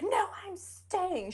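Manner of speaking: high, drawn-out mock protest; mock-stubborn high voice; defiant refusal.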